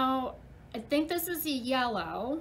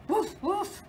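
dog woof; 7 semitones higher than usual. Acted. Teacher barking like the big red dog for the class. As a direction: bark like a friendly dog.

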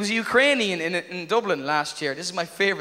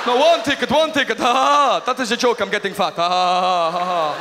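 nervous cover-up laugh. Pretend. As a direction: cover the blunder with a fake laugh. Killer covering his slip.

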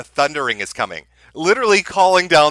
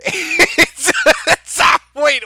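laughing; 13 semitones higher, 4 dB louder, much slower than usual. Real, not acted. Laughing at the clip.

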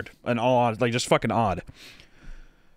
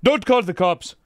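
higher, louder mocking echo; sneering imitation; mocking the driver; 7 semitones higher, 5 dB louder than usual. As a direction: throw his words back at him. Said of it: "what do you fucking mean?".

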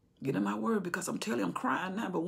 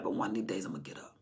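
deep calm promise; 12 semitones lower, 8 dB quieter, faster than usual. Acted. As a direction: drop the voice deep as a vow. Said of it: "Jesus said".

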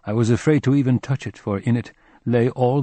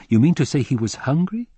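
incredulous question, pitched up; exclaimed disbelief; 6 semitones higher, 4 dB louder than usual. A narrator's reading. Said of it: "I exclaimed".

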